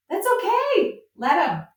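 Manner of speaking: high, lilting reassurance; airy, sing-song brush-off, pitched well up; breezy acceptance. Shown as a demonstration. Farmer telling growers to let critics be, breezily accepting.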